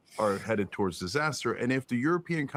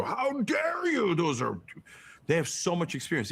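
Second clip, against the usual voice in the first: mock outraged media; 6 semitones higher than usual. Host mocking mainstream media pundits, high hammed-up outrage. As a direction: mimic a scandalised pundit, stretched and shrill-high. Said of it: "mainstream media will lose their shit and go".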